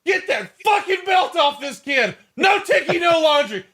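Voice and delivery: screeching parody of a furious boss; mock furious boss. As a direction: ham up a furious boss firing off insults. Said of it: "that entire scene is hilarious to me".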